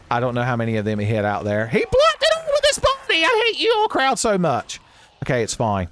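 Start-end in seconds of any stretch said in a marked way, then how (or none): 1.7-4.8 very high-pitched frustrated outburst; outraged yell. Real, not acted. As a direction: yell in exasperation at the game. Outraged at the game's enemies.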